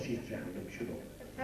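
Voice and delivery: hushing whisper. Acted. Hushing a sobbing partner.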